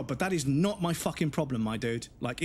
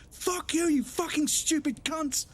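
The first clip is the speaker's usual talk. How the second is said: much higher squawking mock rage; mock abusive caller; 13 semitones higher, slower than usual. Rude caller swears at the call agent in high mock fury. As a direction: ham up a screeching irate customer.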